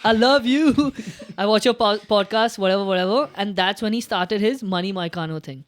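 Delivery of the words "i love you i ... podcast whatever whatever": loud, high, giddy imitation of the fighter shouting; hyped fighter call-out. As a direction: play the fighter yelling into the mic, half laughing. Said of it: "he got the mic and he goes".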